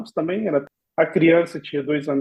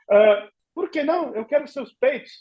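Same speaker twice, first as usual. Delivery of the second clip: naive insisting; 8 semitones higher than usual. Retold or imitated.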